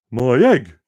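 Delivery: loud excited cry; shouted. Acted. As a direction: shout it out in surprise. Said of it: "shouted Horton".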